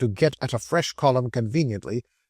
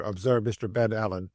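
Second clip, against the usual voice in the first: ordinary delivery, nothing marked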